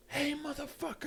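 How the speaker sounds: high mock yell of the cyclist; squeaky imitation of a shout from behind; cyclist's shout. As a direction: pitch it way up as a mocking holler.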